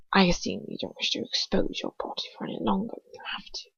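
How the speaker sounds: hushed icy aristocrat, breathed out; a sneering nobleman whispering down at her; cold whispered sneer. Acted. Lucius voiced in a whisper.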